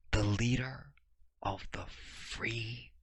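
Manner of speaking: very soft, slow and low; quiet, spaced-out emphasis after shouting; hushed repetition. Real, not acted. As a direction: drop to a near-whisper, drawing out each word.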